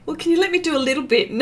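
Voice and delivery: ordinary delivery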